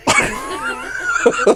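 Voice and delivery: high-pitched laughing speech; words squeezed out through laughter; cry-laughing at his friend; laughing hard. Real, not acted. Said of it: "laugh cry".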